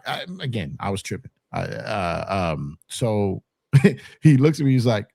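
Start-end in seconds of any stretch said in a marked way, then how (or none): none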